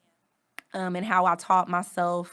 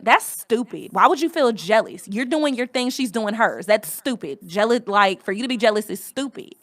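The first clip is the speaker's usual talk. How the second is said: loud, raised-pitch reproach; scolding cousin; 3 semitones higher, 6 dB louder than usual. Retold or imitated. Cousin dismissing the speaker's jealousy, loud scolding. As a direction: imitate a cousin scornfully telling you off. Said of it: "My cousin".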